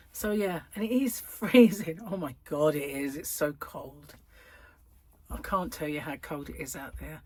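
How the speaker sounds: ordinary talk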